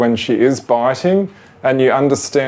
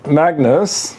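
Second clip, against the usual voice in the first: high, raised call across the room; 7 semitones higher than usual. Real, not acted. Owner calling a pet ferret from another room, high raised call.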